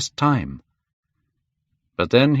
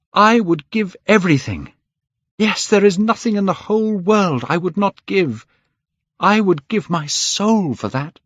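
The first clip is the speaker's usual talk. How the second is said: high, louder, fervent; passionate vow; 9 semitones higher, 4 dB louder than usual. A narrator's reading. Dorian vows his soul for youth, high and loud. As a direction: swear it with everything.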